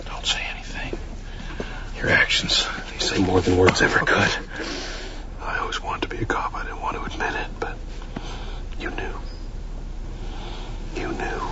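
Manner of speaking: soft intimate whisper. Acted. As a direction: breathe it close and tender. Whispered tender moment after thanks.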